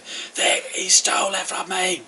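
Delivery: high, strained cry of accusation; real high shout. Acted. Robbed villager yelling about the thief, high-pitched shouting. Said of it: "real high".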